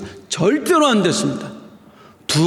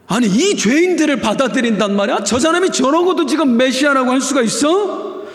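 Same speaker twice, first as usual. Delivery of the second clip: mock-indignant, higher grumbling protest; indignant Pharisees; 7 semitones higher than usual. Pharisees scoffing at Jesus eating with sinners, indignant. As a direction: scoff in disbelief like a scandalised religious leader.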